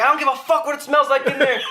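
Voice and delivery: high, exasperated complaint; impatient mock outburst. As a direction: argue through the door, pitched right up. Acted bathroom-door quarrel.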